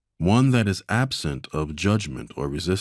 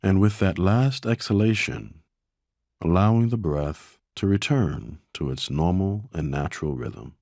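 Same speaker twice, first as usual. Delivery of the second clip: guided meditation; 4 semitones lower than usual. Acted. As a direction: ease them into stillness, a little lower and unhurried.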